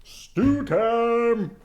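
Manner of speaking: high, loud call to the cubs; Papa Bear calling the cubs to stew. Acted. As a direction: announce dinner with gusto. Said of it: "When Papa cried".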